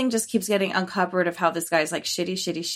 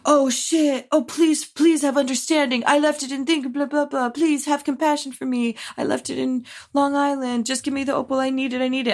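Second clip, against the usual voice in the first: lightly voiced pleading, higher; mock begging voice, pitched up; 6 semitones higher than usual. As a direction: beg for the opal, a bit hammed-up. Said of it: "And he's all".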